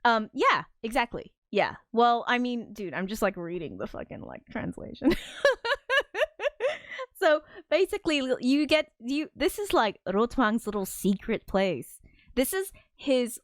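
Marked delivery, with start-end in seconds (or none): 5.2-7.1 high-pitched burst of laughter; laughing. Real, not acted. Laughs at own translation reading.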